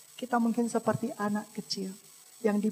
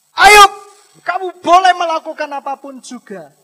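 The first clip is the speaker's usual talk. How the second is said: high, booming shouted decree; thundering preacher voicing God; loud divine ruling; 6 semitones higher, 20 dB louder than usual. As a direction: call out the name, then proclaim permission. Voices God's loud ruling on Job.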